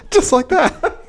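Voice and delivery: high cackling laugh; laughing hard. Real, not acted. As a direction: crack up laughing. Cracks up at hooker joke.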